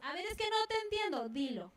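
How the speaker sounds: high-pitched exasperation, falling to a firm order; exasperated mother. Acted. Mother despairing at her crying child, exasperated. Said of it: "las mamás nos desesperamos".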